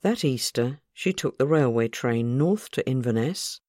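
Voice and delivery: usual speaking voice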